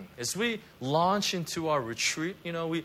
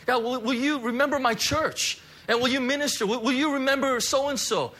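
higher, louder impassioned praying; fervent prayer; 8 semitones higher, 3 dB louder than usual. Acted. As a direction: plead with God at full force. Leads congregation in prayer.

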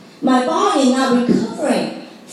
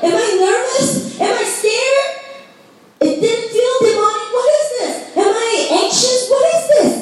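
puzzled alarm; 6 semitones higher than usual. Retold or imitated. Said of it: "And I was like".

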